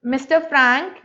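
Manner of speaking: usual speaking voice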